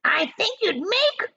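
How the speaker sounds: high, loud, sneering aunt; high mocking hag voice; Aunt Spiker's voice. A narrator's reading. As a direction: jab at her sister in a high voice. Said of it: "Aunt Spiker said".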